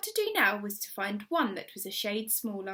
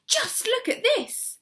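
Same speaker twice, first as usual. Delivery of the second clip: high, slow, thrilled; excited; 13 semitones higher, 5 dB louder, slower than usual. A narrator's reading. Missus Silver calling up to Mister Hoppy, high and thrilled. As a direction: urge him to look, bursting with glee. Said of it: "shrill with excitement".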